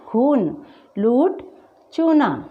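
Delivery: long, weighted oo in "खून", "लूट", "चूना". Shown as a demonstration. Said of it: "इसके बिल्कुल विपरीत". ("खून, लूट, चूना" में बड़ा ऊ)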